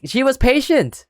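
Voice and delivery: ordinary talk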